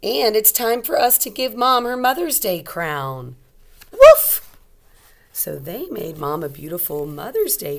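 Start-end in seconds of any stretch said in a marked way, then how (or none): 0.0-3.4 louder, bright storybook voice; reading to children. A narrator's reading. Teacher reading a picture book aloud to young kids. 3.9-4.4 high, loud yappy puppy woofs; puppy bark. Acted. Teacher reading Biscuit, barking as the puppy.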